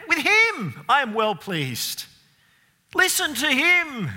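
God the Father's booming voice. Acted. Preacher voicing the Father shouting from heaven, proud and booming. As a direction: call down from heaven bursting with pride. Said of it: "He speaks audibly from heaven".

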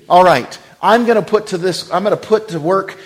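loud, high preaching as Boaz; voicing Boaz. Preacher voicing Boaz planning to feed Ruth, loud and high. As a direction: speak boldly as Boaz resolving to help.

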